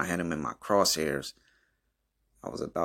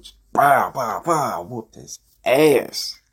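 higher, louder mock "bow bow" punches; punch sound effects; 6 semitones higher, 4 dB louder, slower than usual. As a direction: beat out each hit like a cartoon fight. Acting out punches.

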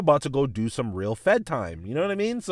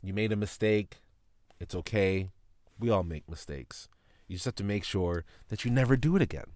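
softer, lower, kindly forgiving; imagine the teacher letting him off softly; mild imitation of a soothing teacher; gentle teacher; 4 semitones lower, 6 dB quieter than usual. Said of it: "just say".

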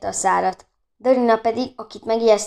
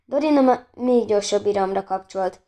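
ordinary delivery, nothing marked

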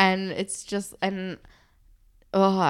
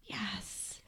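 hushed, mock-dramatic whisper; whispered cheer; 16 dB quieter than usual. Cheering on Anya's hair release, whispered cheer. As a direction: whisper it as secret encouragement.

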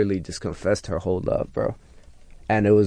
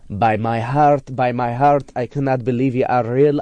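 put-on high, pleading East African accent; imitating female fan's accent; 5 semitones higher than usual. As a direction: imitate an Eritrean woman's gushing declaration in accent. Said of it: "she was like".

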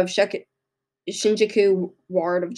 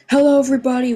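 higher, bright-voiced hello to the viewers; upbeat host greeting; 6 semitones higher, 4 dB louder, much faster than usual. Real, not acted. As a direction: open the show with energy. Vlog intro greeting to viewers.

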